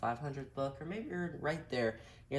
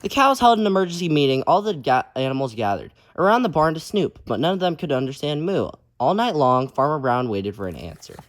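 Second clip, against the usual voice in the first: lively, loud storybook reading; reading to children; 13 dB louder, slower than usual. A narrator's reading. Teacher reading a picture book aloud to kids, lively. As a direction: read it out big for the kids.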